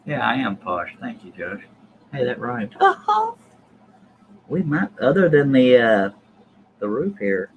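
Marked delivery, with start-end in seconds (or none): 2.8-3.3 short, high-pitched "oh-ho"; amused high chuckle; high laugh. Real, not acted. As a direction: let out a high little hoot of laughter. Laughs at own rhyme.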